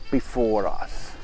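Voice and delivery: usual speaking voice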